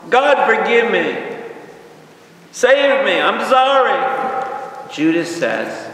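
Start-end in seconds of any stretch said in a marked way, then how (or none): 0.1-4.9 high, loud, drawn-out acted wail; crying plea. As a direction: cry out in desperate remorse. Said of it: "and he's crying".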